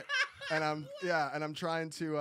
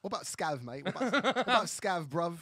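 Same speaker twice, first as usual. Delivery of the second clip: loud laughing Cockney banter; mock Cockney; 3 semitones higher, 7 dB louder than usual. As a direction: join in with a London bruv voice. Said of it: "worst accent".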